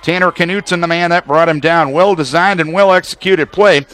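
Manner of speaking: ordinary delivery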